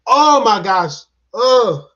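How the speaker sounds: loud groaning regret; exasperated outburst. Real, not acted. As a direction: burst out loudly, annoyed with yourself. Regrets spoiling the moment.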